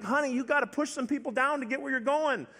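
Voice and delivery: high-pitched, hammed-up fatherly coaxing; mock urging. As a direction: imitate a dad urging his kid on. Urging his timid daughter.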